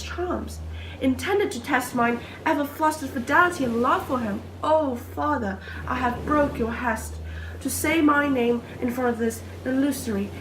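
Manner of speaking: lovestruck maiden, wondering aloud in theatrical verse; earnest stage soliloquy, swelling from musing to ardent love; Miranda's soliloquy. Acted. Performs own Miranda soliloquy, then explains it.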